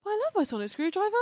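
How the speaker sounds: high, fast mock doting voice; imitating the Doctor. Friend imitates the Doctor gushing about his screwdriver. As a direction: gush it like a besotted child. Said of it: "And he's like".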